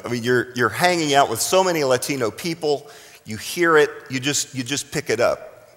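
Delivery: normal talking voice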